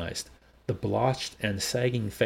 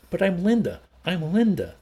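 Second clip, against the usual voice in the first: high, loud insistence; desperate; 9 semitones higher, 6 dB louder than usual. A narrator's reading. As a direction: insist desperately over laughter. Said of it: "Desperately she clung".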